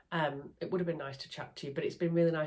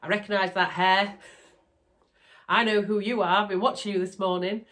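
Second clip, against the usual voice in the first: excited greeting; 3 semitones higher, 7 dB louder than usual. Retold or imitated. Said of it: "came up to me and went".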